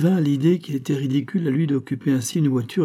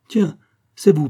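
loud, high cry of recognition; surprised exclamation; 6 semitones higher, 7 dB louder than usual. A narrator's reading. The doctor recognises the farmer in the crowd, exclaiming. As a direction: exclaim on spotting an old friend. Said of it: "s'écria le docteur".

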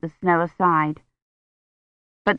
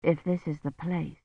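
very soft, numb, fading; numb thought; 11 dB quieter than usual. A narrator's reading. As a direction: barely breathe the doubt. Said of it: "Kivrin thought numbly".

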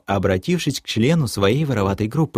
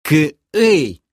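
higher, sharp bark; barked "hey"; 6 semitones higher, 4 dB louder than usual. A narrator's reading. Thief leader to his joking companion, higher sharp bark. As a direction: cut in with a short bark. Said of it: "рявкнул я".

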